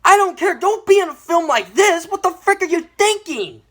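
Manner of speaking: high, indignant rant; outraged, high-pitched pleading; exasperated scolding. Acted. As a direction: berate the actor as if face to face. Scolds an actor for taking role.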